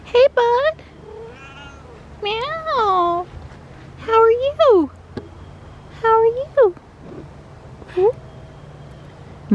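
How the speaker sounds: very high cooing pet voice; sing-song at the cat. Real, not acted. Asking the cat how it is, very high baby talk.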